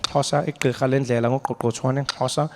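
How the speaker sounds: Xhosa words with clear tongue clicks. Shown as a demonstration. Showing off Xhosa clicks to the audience, clicks crisp. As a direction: click the consonants in igqirha and ngoqoqothwane. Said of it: "Xhosa is one of the languages with the clicks".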